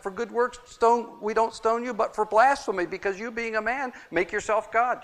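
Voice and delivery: high, sharp accusing voice; accusing rulers. Retold or imitated. Rulers accusing Jesus of blasphemy, high accusing voice. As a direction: throw the charge back at him.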